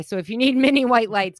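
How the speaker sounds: ordinary delivery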